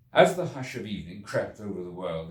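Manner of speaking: ordinary delivery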